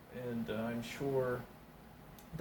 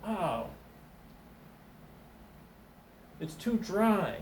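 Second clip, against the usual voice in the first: picky taster; 6 semitones higher, slower than usual. Acted. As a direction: turn up your nose at it. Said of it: "And the guy goes".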